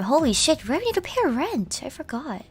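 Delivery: ordinary talk, nothing marked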